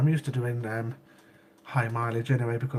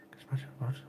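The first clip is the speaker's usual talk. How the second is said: whispered aside; 8 dB quieter than usual. Real, not acted. Marvelling at no dislikes.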